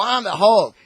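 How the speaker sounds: put-on loud, high kid boast; kid playing Hulk. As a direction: shout it like a kid at play. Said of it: "the kid's gonna go".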